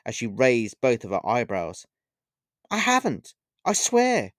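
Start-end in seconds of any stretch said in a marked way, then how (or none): 2.7-4.3 high, shrill protest. A narrator's reading. Olivia swears her innocence to the witch, shrill. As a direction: blurt it out much higher. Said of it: "accidentally in a shrill tone of voice".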